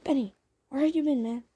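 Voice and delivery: put-on fast, high, loud kid call; quick rowdy kid shout; Benny voice. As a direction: rattle it off like a street kid. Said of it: "That's more like a Benny voice".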